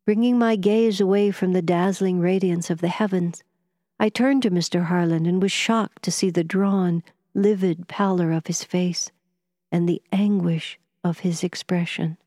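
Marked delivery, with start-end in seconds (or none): none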